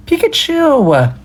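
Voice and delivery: long, high drawn-out cry; excited call. Real, not acted. Streamer shouts a sudden name, high and drawn out.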